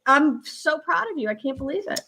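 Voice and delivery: usual speaking voice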